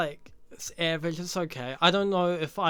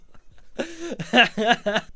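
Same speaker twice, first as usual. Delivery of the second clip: big laugh; 8 semitones higher, 7 dB louder than usual. Real, not acted. Laughs at his own remark.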